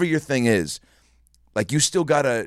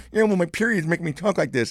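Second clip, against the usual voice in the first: much higher, strained fake voice; high put-on voice; 8 semitones higher than usual. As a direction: keep the squeaky voice going. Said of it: "you didn't sound like that".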